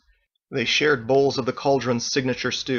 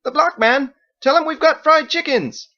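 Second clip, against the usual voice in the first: high, loud shout across the house; shouting back; 8 dB louder than usual. A narrator's reading. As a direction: holler back eagerly. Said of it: "Fergus shouted back".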